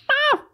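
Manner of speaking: one very high imitated yelp; toddler cat noise. Imitating his daughter's cat thing again, high yelp. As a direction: squeak out a child's cat cry. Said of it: "my daughter's doing this cat thing now".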